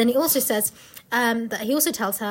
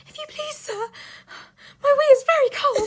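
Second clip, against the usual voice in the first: high pleading voice; 13 semitones higher than usual. Pretend. Pitiful voice for Edmund.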